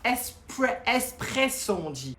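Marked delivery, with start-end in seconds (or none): 0.9-1.7 espresso pronounced carefully for a pupil; teacher's firm "espresso"; model pronunciation. Shown as a demonstration. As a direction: show how to say "espresso", starting on a clean vowel. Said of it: "La prononciation".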